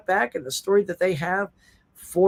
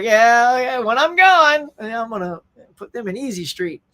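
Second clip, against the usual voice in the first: a hammed-up greedy relative; mock gleeful heir; 4 semitones higher, 5 dB louder than usual. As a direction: play the family cashing in, gleefully.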